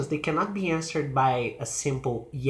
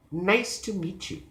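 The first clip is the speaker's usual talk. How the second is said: high "Nice", falling to "you"; finished drop on "meet you"; falling statement; 5 semitones higher than usual. Shown as a demonstration. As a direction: stress "Nice", then slide down. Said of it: "they use falling intonation".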